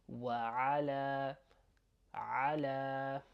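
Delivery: "وعلى" with the last a held longer; slow teaching model of "وعلى", long final a; lengthened final vowel. Shown as a demonstration. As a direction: draw out the end of "على". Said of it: "it goes on just a little bit longer".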